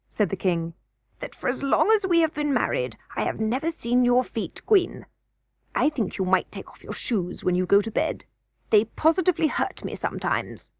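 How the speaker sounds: amused king humouring his wife; goblin king. A narrator's reading. King laughing off the queen, lightly voiced.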